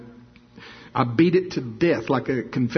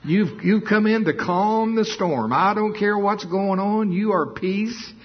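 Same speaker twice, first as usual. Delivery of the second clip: high, loud fervent preaching; 9 semitones higher, 3 dB louder than usual. Acted. Preacher rousing the church with high loud preaching. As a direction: preach it with fire.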